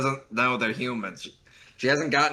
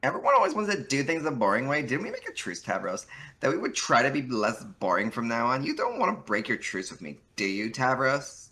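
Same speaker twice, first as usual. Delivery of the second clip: put-on Valley Girl voice; 5 semitones higher, 3 dB louder than usual. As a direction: play a smug Valley Girl. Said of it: "You gave her a very good Valley Girl voice".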